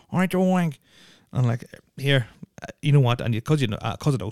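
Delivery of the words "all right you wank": quick high-pitched mock catchphrase; mimicked local slang. Host quoting the slang everyone spread, high-pitched mimicry. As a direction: throw out the catchphrase in a raised mocking voice. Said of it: "I agree with that fucking".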